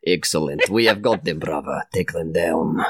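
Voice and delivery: put-on low Russian-accented command. Fighter to his brother in battle, Russian accent. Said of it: "I speak in a Russian accent as well".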